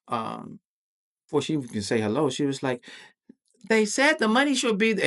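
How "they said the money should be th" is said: high, pitched-up imitation of her tone; high-voiced mock manager; raised pitch. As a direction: mimic her tone going up. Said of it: "her tone just went up a little pitch".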